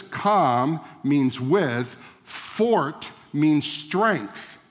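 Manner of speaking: very slow, word by word; slow teaching emphasis. Shown as a demonstration. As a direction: lay out each meaning like a lesson. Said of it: "is this".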